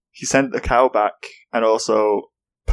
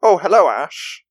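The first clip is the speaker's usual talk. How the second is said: higher, smug cartoon villain voice; mock Professor Oak; 8 semitones higher than usual. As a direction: play the professor as a sly thief. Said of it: "it's more like".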